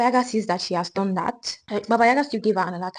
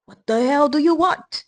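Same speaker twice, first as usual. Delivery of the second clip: loud, high, rough witch demand; witch yelling; 8 semitones higher, 7 dB louder than usual. Acted. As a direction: bark it at the trembling girl. Baba Yaga snaps at Vasalisa.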